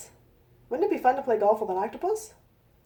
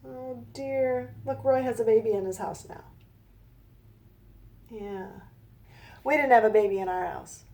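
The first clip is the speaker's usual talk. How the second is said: ordinary delivery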